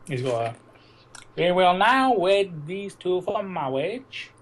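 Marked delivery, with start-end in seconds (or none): 1.4-4.3 slow, higher impression of the priest; Princess Bride priest. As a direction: drag out each word like the wedding priest. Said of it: "the priest in Princess Bride".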